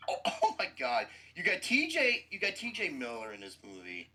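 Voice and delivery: usual speaking voice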